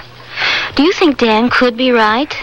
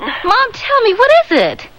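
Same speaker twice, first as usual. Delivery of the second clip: high, urgent and scared; pressing her mother for the truth; anxious pleading; 10 semitones higher than usual. Acted. Daughter urgently presses frightened mother.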